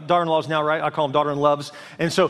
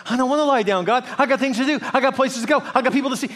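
put-on higher-pitched childish objection; whiny protest; 6 semitones higher, faster than usual. Believer arguing with God about rest, whiny protest. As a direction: reel off excuses in a raised pitch.

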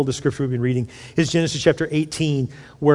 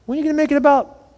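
higher, quick teasing voice of God; mock divine nudge, pitched up and fast; God's rebuke; 11 semitones higher, faster than usual. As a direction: poke at the pray-er as God would. God voiced answering the pray-er.

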